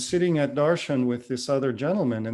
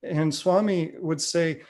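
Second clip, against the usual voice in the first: normal talking voice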